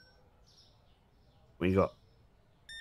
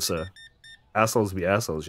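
ordinary talk, nothing marked

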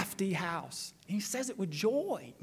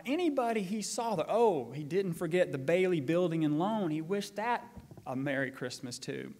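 normal talking voice

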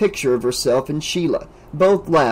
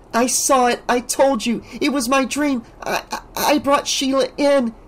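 distraught Alice; 11 semitones higher than usual. A narrator's reading.

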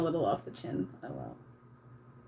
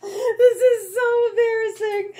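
words squeezed out through high, louder laughter; laughing; 13 semitones higher, 6 dB louder than usual. Real, not acted. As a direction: laugh helplessly at your own mistake. Laughing at her own painting.

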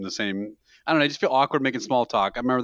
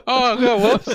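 laughing mock apology; 11 semitones higher, 7 dB louder, slower than usual. Mimicking himself dropping food.

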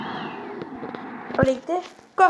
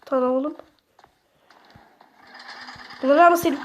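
ordinary talk, nothing marked

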